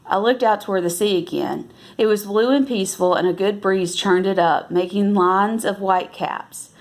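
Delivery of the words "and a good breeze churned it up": usual speaking voice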